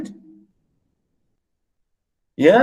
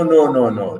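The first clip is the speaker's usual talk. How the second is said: ordinary delivery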